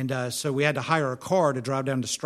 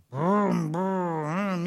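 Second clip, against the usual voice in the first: mimed car engine revving, pitched up; imitated engine noise; 4 semitones higher than usual. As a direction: make engine noises to get past the language gap. Mimics engine to French man.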